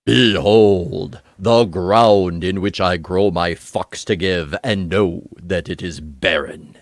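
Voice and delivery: low, slow, portentous scripture voice; Bible voice. Acted. Host performing a viewer's super chat message in a Bible voice. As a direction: intone it slowly like solemn holy writ. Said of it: "I'll do my Bible voice for this one".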